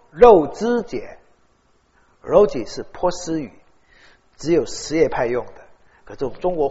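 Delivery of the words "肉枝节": 肉祭 drawn out slowly and louder; slow careful 肉祭. Shown as a demonstration. Pastor showing the congregation how the Hui say it, slow and stressed. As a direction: spell out 肉祭 as the Hui say it. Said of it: "但是回族都叫做肉祭".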